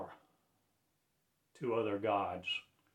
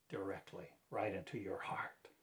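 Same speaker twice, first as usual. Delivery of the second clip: breathy hushed whisper; a sudden soft whisper; 3 semitones lower, 8 dB quieter than usual. Acted. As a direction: lean in and whisper it to the heart. Intimate aside within sermon.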